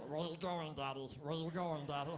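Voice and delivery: imitating a daughter's croaky voice; frog in the throat. Daughter asking her dad where he's going, croaky imitation. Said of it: "gets a frog in her throat. That's how she talks".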